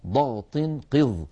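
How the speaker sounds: slow, emphatic ḍaghṭ qiḍ; slow emphatic letter demo; Arabic letters demo. Shown as a demonstration. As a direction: sound out ضغط قض slowly and heavily. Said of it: "خص".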